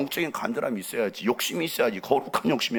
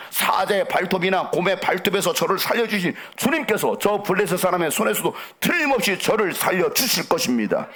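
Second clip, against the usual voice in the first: higher-pitched, emphatic declaration of faith; bold conviction; 5 semitones higher than usual. Acted. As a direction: proclaim it fearlessly as David before the king. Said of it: "얼마나 담대합니까?". (확신에 찬 담대한 외침)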